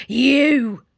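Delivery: loud sudden cry; spring the word on her; wolf cry. Acted. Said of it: "cried the wolf".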